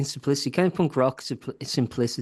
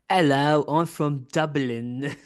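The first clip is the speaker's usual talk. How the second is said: put-on slow, prim British-sounding accent meant as Irish; an American claiming Dublin in a British voice, slowly; fake Irish that sounds British; 2 semitones higher, much slower than usual. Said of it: "the second one sounded British".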